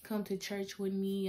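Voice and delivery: usual speaking voice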